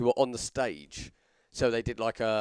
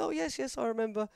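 put-on high, fluttery old-teacher voice, much higher; 12 semitones higher than usual. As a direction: mimic a prim elderly schoolteacher remembering. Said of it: "She said".

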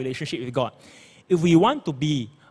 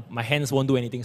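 ordinary delivery

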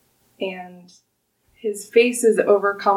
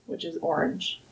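ordinary talk, nothing marked

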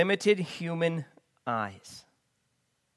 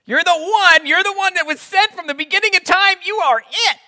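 much higher and louder, gushing acclaim; excited Peter; 13 semitones higher, 11 dB louder than usual. Retold or imitated. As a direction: proclaim it like an awestruck disciple. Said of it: "this is the guy who a second ago was like".

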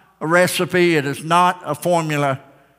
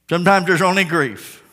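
ordinary delivery

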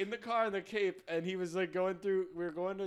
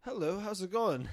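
louder, put-on British accent; playful attempt at a British accent; 2 semitones higher, 6 dB louder than usual. Shown as a demonstration. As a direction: greet in an amateur British accent. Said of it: "Let's hear your British accent".

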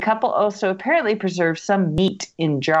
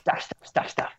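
whisper; 7 dB quieter than usual. Pretend. Skipping filler text.

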